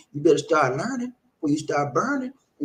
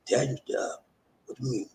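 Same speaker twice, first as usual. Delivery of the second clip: whisper; 5 semitones lower, 8 dB quieter than usual. Acted. Repeats the term in a whisper.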